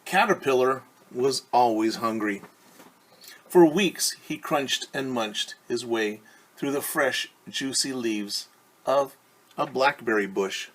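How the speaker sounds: ordinary delivery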